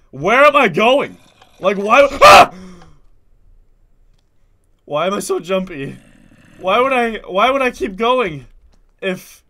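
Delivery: usual speaking voice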